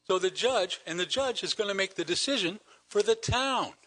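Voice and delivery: ordinary talk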